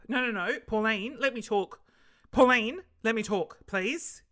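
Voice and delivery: loud, insistent pretend cut-in; mock debate interruption. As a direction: talk over a pretend opponent. Pretends to argue on Q and A.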